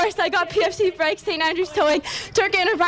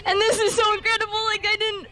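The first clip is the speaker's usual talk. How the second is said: tearful. Real, not acted. Teen winner thanking fans while choking up with tears. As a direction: voice breaking with tears. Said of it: "I'm gonna get emotional".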